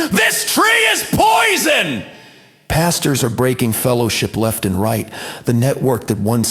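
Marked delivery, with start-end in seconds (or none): 0.1-2.0 very high, drawn-out yell; slow, high-pitched shouted accusation; mock screaming. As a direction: scream it out like an alarm. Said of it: "That's your fruit screaming".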